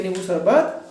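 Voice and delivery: usual speaking voice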